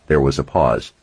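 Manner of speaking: plain reading voice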